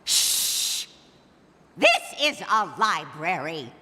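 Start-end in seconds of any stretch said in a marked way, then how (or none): 0.0-0.9 shushing. Acted. Librarian shushing.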